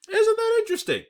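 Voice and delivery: very high, loud sarcastic question; a high, loud sarcastic jab; sarcastic squeal. Acted. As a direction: squeak it out in mock amazement. Sarcastic repeated remark.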